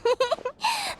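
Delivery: high, soft little giggle. Acted. Pleased that Celino finally let her in, giggling.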